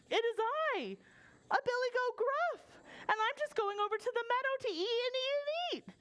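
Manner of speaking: high-voiced plucky goat; middle billy goat. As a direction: announce yourself in a high goat voice. Middle goat answers the troll.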